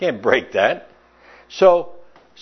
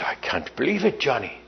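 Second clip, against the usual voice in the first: aghast priest; 6 dB quieter than usual. Retold or imitated. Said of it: "The priest is aghast".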